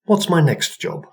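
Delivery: put-on a willing young maid asking; eager girl. A narrator's reading.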